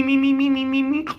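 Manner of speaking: rapid-fire high squeaked syllables; high flying-away noise. Retold or imitated. Storyteller imitating Nomu sent far, high repeated squeaks. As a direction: squeak like a body skidding away.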